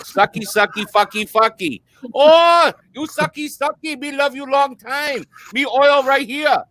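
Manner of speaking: high, slow broken-English come-on; mock massage worker. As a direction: tease it in a put-on sing-song voice. Mocking a massage parlour worker.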